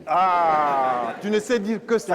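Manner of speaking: loud, indignant comeback; scornful protest. Acted. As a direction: throw the accusation back loudly. Quarrel, scolds a violent colleague.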